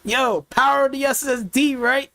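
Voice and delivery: high, hammed-up enthusiastic voice; sarcastic fake enthusiasm, pitched high; mock excited coworker chat. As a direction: pitch it up, mock-eager to share. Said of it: "Be like".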